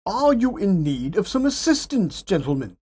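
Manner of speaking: a new character calling in, pitched well up; third voice from the night. A narrator's reading. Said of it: "came a third voice".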